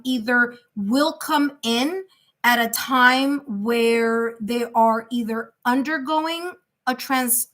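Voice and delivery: ordinary talk, nothing marked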